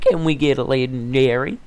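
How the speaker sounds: loud, high hopeful call; excited. Real, not acted. Gamer opening a chest for viewers, loud excited hope. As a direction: pump up the viewers.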